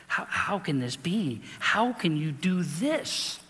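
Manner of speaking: pleading doubt. Retold or imitated. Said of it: "I had that weakness".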